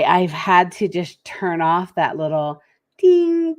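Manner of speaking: ordinary delivery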